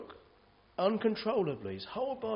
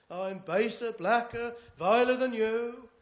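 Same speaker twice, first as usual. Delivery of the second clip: climbing higher and louder word by word; building confession; 8 semitones higher, 6 dB louder than usual. Acted. To the Apostle Paul, the believer insists loudly, building up. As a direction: build each word over the last. Arguing with Paul over sin.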